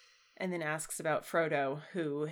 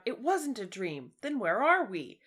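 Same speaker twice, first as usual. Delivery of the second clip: louder, higher astonished cry; cried aloud; 5 semitones higher, 8 dB louder than usual. A narrator's reading. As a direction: read Sam's cry with rising amazement. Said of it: "Sam cried aloud".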